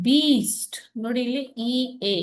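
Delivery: normal talking voice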